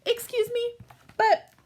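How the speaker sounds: normal talking voice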